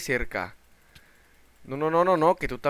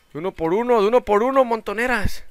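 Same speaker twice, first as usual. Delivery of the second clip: higher, louder mock protest; excited scolding; 8 semitones higher, 7 dB louder than usual. Real, not acted. Gamer swarmed by mobs, excitedly protesting. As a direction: tell the swarm to come one at a time.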